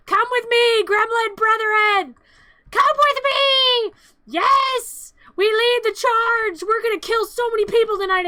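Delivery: high, loud, slow rallying shout; gremlin war cry. Acted. Gremlin leader calling his brethren to the charge, high loud shout. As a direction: rouse the gremlin horde.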